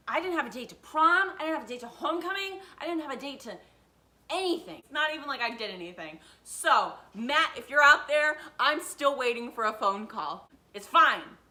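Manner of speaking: high, loud comic rant; built-up anger. Real, not acted. Woman still waiting for his phone call, ranting in built-up anger. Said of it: "I have a lot of built up anger over this".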